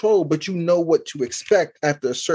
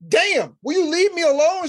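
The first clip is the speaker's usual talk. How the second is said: much higher, fed-up mock outburst; exasperated outburst; 12 semitones higher than usual. Dad retelling how he snaps at his emotional daughter, exasperated. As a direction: throw up your hands, fed up. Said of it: "and I'd be like".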